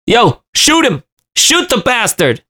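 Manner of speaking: high urgent call; high eager shout to shoot; urgent yell. A narrator's reading. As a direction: yell at the caretaker to fire. Narrator urging caretaker to shoot.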